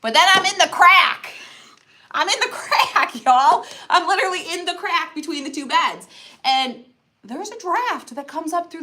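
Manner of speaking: loud, high comic complaint; exasperated. Real, not acted. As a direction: protest it, half laughing.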